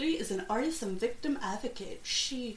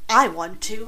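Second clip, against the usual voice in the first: loud wail; 11 dB louder than usual. Real, not acted. Host wants to flee.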